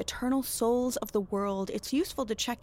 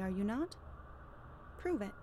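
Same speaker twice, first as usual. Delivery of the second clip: soft, controlled dare; quiet challenge; 15 dB quieter than usual. Acted. Witch demands reverend prove his credentials, quietly challenging.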